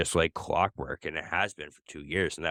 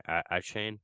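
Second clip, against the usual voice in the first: ordinary talk, nothing marked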